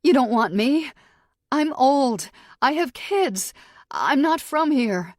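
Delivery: high, loud desperate pleading; panicked blabbering. Acted. Captive bargaining with her captor, panicked blabbering. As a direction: blurt out excuses in a panic. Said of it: "I blabber".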